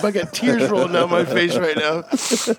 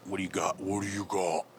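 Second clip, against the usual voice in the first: dropping to a low voice; 9 semitones lower, 12 dB quieter than usual. Pretend.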